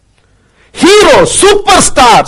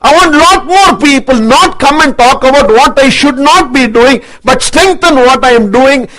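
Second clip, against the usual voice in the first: ordinary delivery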